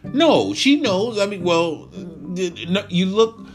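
high, loud exasperated telling-off; incredulous scolding. Acted. Friend scolding John about the girlfriend, high exasperated outburst. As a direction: shake him out of it.

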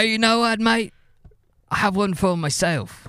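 high-pitched put-on Australian; mock Australian accent. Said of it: "I don't know Australian accents".

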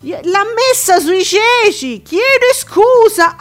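high, slow outraged exclaim; indignant exclamation. Retold or imitated. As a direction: draw it out, scandalised. Retelling lady's outburst, indignant.

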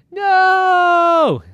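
high loud protesting cry; shout. Acted. Irwin protesting to daddy, loud high no. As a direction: cry out in refusal. Irwin hears he's stuck forever.

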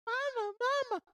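put-on high whiny little kid; shrill child calling mama. Kid voice in joke scene.

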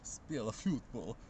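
put-on slow heavy Swedish accent; Zlatan. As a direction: drag it out in a thick Swedish accent. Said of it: "a really good Swedish voice, what about Zlatan?".

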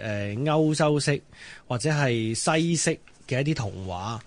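ordinary talk, nothing marked

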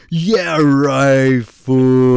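loud, scoffing bro voice; mocking friend. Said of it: "[name], of course".

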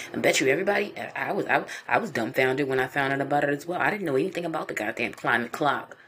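ordinary delivery

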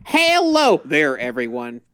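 mock Southern accent. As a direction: mimic someone's Southern accent. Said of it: "you just basically did".

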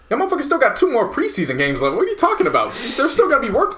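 high-pitched, incredulous rant; exasperated retort. Retold or imitated. Podcaster firing back at the manager's week one quote, exasperated.

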